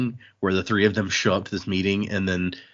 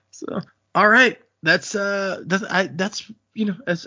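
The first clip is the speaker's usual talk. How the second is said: trying to talk past the giggles; laughing; 11 semitones higher, slower than usual. Real, not acted. Host laughing after the Wayne joke, laughing through words. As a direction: still laughing, higher, words bubbling through.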